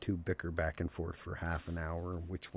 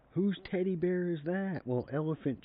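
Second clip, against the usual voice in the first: put-on much higher, louder kid-sister voice; imitated sister; 12 semitones higher, 5 dB louder than usual. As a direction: put on a little sister's voice, asking in wonder. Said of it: "My sister come out".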